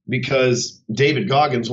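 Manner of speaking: normal talking voice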